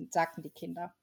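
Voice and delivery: ordinary delivery, nothing marked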